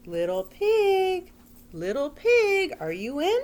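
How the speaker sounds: high sing-song call to the pig. Retold or imitated. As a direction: call sweetly through the door. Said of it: "so I called out".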